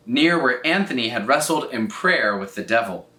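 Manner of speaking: usual speaking voice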